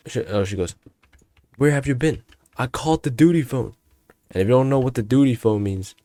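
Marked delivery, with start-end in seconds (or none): 1.6-3.7 higher-pitched mock teacher telling-off; teacher scolding. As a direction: imitate a teacher confronting a student. Voices teacher confronting him.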